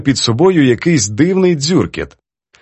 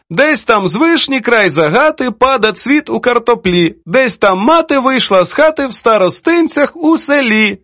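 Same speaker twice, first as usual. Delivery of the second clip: high, sing-song verse recitation; declaiming; 11 semitones higher than usual. A narrator's reading. Poetess recites editor's verse to prove her point, declaiming. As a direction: recite the whole verse with flourish. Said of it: "І вона продекламувала".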